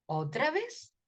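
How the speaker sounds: normal talking voice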